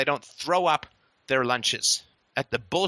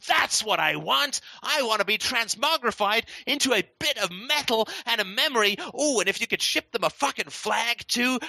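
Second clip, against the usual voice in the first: high, gushing sarcastic volunteer voice; mock eager recruit; 7 semitones higher than usual. Mock recruit squealing eagerly to the officials sending him off. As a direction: play a chirpy fool begging to be sent to war.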